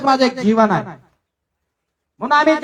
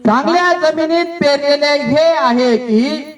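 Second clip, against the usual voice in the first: slow verse reading. Real, not acted. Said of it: "reading verse slowly aloud".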